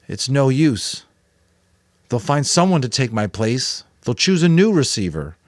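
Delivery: put-on Jonas, anxious boy. A narrator's reading. Jonas telling the Giver it is no use, anxious. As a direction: protest that it is hopeless.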